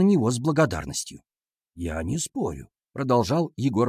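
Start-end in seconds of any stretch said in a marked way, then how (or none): 1.8-2.7 lightly voiced teacher, low and soft; calm male teacher. A narrator's reading. Class teacher conceding to pupils, low soft voice.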